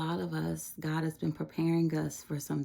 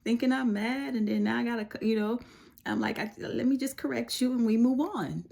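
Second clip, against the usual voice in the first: higher, exasperated mom venting; a mock-exasperated mother, pitched up; frustrated mom; 7 semitones higher than usual. Mother venting at her touchy daughter, higher and exasperated. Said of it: "I get a little frustrated".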